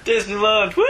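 high, loud whooping cheer; excited cheer. Pretend. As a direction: whoop it like a thrilled tourist. Aliens cheer for Disneyland.

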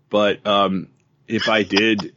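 ordinary talk, nothing marked

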